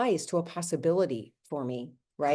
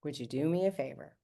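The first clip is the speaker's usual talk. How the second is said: much softer, asked again after a long pause; softer repeat; 3 semitones lower, 7 dB quieter than usual. Retold or imitated. Friend repeats his request quietly to win her over. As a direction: drop the voice and ask again gently.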